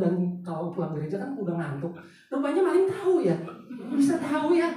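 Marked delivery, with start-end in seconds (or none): 2.3-4.7 laughing, pitched higher and louder. Real, not acted. Preacher to congregation, laughing at the thief's cunning. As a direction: laugh through the words in disbelief.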